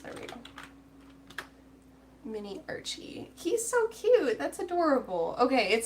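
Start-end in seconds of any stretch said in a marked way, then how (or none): 3.4-5.4 high, loud burst of adoration; delighted high-pitched cooing; real gushing. Real, not acted. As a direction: squeal over something adorable, then ease down. Gushing over the mini dinosaur.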